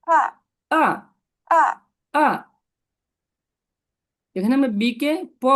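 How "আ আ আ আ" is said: আ dropping sharply; fourth-tone falling আ. Shown as a demonstration. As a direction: cut আ downward from high. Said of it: "এটা falling ভাবে বলবো এটা".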